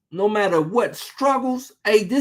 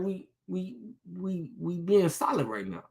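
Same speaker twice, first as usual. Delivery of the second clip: 1 hushed pretend calm; 10 dB quieter than usual.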